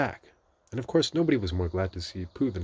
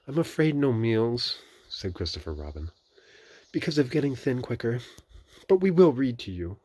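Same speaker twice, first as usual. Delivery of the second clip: Christopher Robin; 6 semitones higher, 6 dB louder than usual. A narrator's reading.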